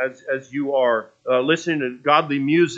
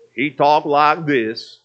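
slow, drawn-out, louder play-character voice; weird character voice; 5 dB louder, much slower than usual. Shown as a demonstration. Preacher showing how his play character talked, weird voice. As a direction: put on a weird cartoonish stage voice, stretched out. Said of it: "that weird voice that I can't do".

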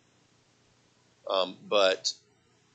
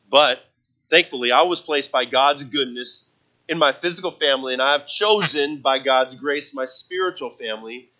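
rising preaching; 5 semitones higher, 8 dB louder than usual. Acted. Preaching builds up again.

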